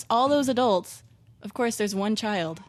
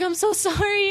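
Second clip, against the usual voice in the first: put-on much higher, gushing apology to a child; high sorry apology; 12 semitones higher, 4 dB louder than usual. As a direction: squeak out a sorry as if to a little kid. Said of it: "And I was like".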